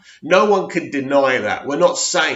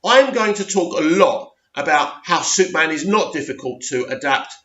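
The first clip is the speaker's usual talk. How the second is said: normal talking voice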